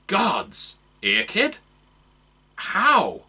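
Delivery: high, rising stunned cry; shocked recognition. Acted. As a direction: disbelief at seeing him. Man recognises Eakid.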